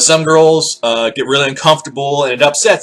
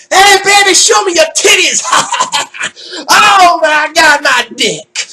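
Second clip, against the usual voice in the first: loud, high leering shout; mock leering catcall, shouted high; crude catcall; 4 dB louder than usual. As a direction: yell it like a crude street pig. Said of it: "They're fucking pigs. Like".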